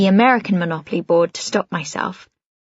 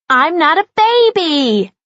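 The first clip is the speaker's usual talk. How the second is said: put-on high, loud, slow little girl; cross child; 9 semitones higher, 5 dB louder, slower than usual. A narrator's reading. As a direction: stamp it out like a cross toddler. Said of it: "looking really cross".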